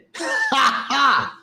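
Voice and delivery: high burst of real laughter; big laugh. Real, not acted. Laughs at his own story.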